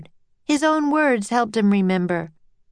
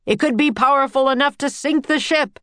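higher alarmed warning; shouting; 6 semitones higher, 3 dB louder than usual. A narrator's reading. Captain caught up in panic, shouted warning. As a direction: warn them with raised voice. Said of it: "he shouted".

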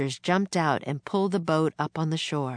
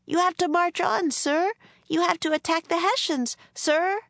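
shouted; 13 semitones higher than usual. A narrator's reading. Girl shouts at the general from the boat, urgent. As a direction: shout it out to stop him.

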